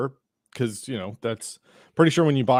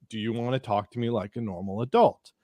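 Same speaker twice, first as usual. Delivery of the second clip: lower, measured, controlled repetition; deliberate repeat; 4 semitones lower, 4 dB quieter than usual. Retold or imitated. Narrator repeats himself to angry man, low and deliberate. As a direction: repeat it evenly and deliberately, pitched down.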